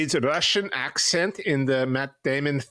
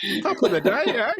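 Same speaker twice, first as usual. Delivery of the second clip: mock Irish accent; 11 semitones higher than usual. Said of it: "There's accent work".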